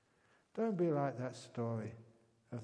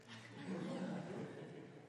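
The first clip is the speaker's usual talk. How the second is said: quick airy chuckle at his own joke; breathless giggle; 6 semitones higher, 8 dB quieter than usual. Real, not acted. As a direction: let a silent laugh slip.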